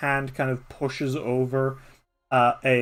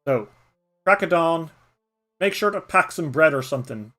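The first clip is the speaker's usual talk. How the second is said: louder, bossy badger voice; gruff old badger; 3 semitones higher, 6 dB louder than usual. Badger Nullig correcting the boy, loud and bossy.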